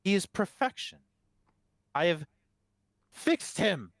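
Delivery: high, emphatic proclamation, phrase by phrase; fervent abbot. Acted. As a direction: proclaim it as a zealous cleric. Game master voices fervent abbot defending work.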